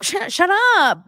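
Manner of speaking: loud, high mock snap; exasperated outburst. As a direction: pretend to lose it and shout her down. Imagined snapping back at Madison.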